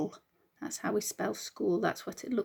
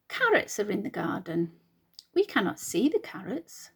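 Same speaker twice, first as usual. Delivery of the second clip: put-on reading to children. Teacher reads picture book aloud.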